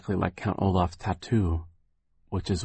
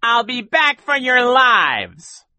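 very high, slow, loud villain cry; menacing call; 8 dB louder, much slower than usual. A narrator's reading. Count Olaf threatens the orphans' lives, shouting slowly and shrilly. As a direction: draw the threat out at full pitch. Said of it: "he called".

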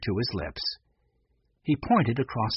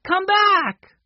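high, loud call to a dog; 7 dB louder than usual. A narrator's reading. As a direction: call the runaway dog back, voice pitched way up. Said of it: "she called".

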